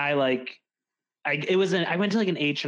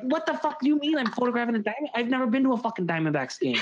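high, fast, exasperated outburst; incredulous swearing rant; outraged; 10 semitones higher, faster than usual. Retold or imitated. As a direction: fire it back at him in disbelief. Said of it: "And I'm like".

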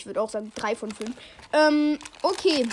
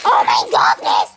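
low shouted oh my god; shocked shout; 7 dB louder, slower than usual. Real, not acted. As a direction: blurt it out in shock. Sees how many Nerds are in the pack.

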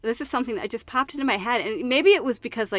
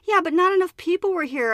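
complaining; 8 semitones higher, 4 dB louder than usual. Retold or imitated. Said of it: "I complained".

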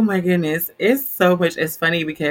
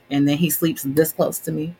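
normal talking voice

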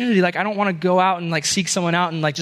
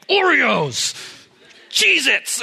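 mock angry listing; 6 semitones higher, much slower than usual. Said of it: "and I'm just like".

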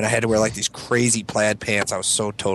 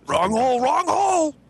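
high, rising mock panic; 14 semitones higher than usual. Friends riffing on Superman, high panicked yelps. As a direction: yelp it higher and higher. Joke robot scene.

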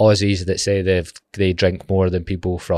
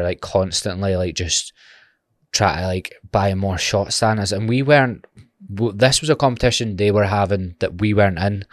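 ordinary talk

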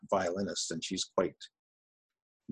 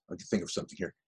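ordinary delivery

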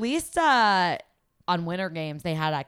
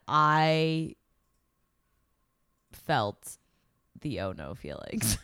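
careful, halting word choice; 3 semitones lower, slower than usual. Real, not acted. Podcaster trying not to say "like", halting and careful. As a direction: pick each word gingerly, voice sinking. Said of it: "Trying not to say it".

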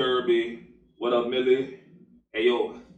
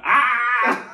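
yelp; 11 semitones higher, 7 dB louder than usual. Real, not acted. Showing off new bald head.

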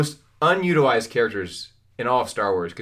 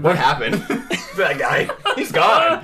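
half-laughing as he pretends not to get the scene; laughing disbelief; 3 semitones higher, 4 dB louder, slower than usual. Fan retelling a confusing scene to his friends, laughing. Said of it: "I'm like".

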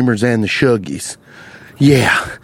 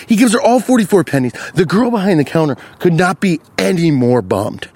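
ordinary talk

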